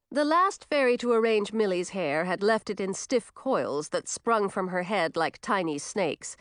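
ordinary delivery

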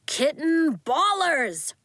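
slow, drawn-out bark. A narrator's reading. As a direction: bark it like a coach. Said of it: "barked Coach".

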